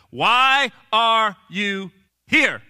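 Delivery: loud, drawn-out word by word; very slow, pressing demand; slow, emphatic question. Retold or imitated. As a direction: space out every word with weight.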